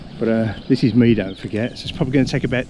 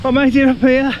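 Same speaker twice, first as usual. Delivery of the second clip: excited; 9 semitones higher, 5 dB louder than usual. Real, not acted. Proud after brutal climb.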